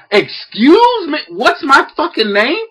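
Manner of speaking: slow, high outraged excuse me; stunned disbelief. Retold or imitated. Dad stunned at the boy's question, slow and incredulous. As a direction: drag it out in shock.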